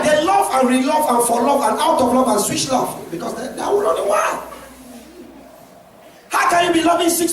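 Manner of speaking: high, loud, fired-up sermon; impassioned pulpit shouting; full-force preaching. Real, not acted. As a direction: fire challenging questions at the congregation.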